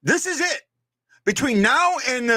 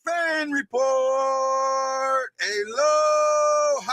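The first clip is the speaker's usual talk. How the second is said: high, long drawn-out announcer shout; show-opening holler; 5 semitones higher, 8 dB louder, much slower than usual. Real, not acted. Host opening the fan report, drawn-out holler. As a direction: stretch each word out in a high holler.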